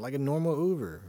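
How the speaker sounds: high, loud, quick burst; excited emphasis. Real, not acted.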